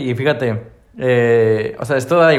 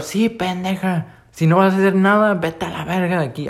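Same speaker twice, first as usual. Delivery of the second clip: imitating crowd jeers; 6 semitones higher than usual. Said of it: "la gente de alrededor".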